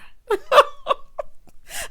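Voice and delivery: high, loud cackle; big laugh. Real, not acted. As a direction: burst into high, helpless laughter. Laughs at feeling old.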